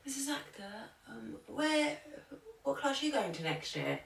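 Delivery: put-on high, soft, hesitant child's question; imitated pupil. Pupil asking the teacher about next year, imitated small high voice. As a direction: ask timidly in a small high voice.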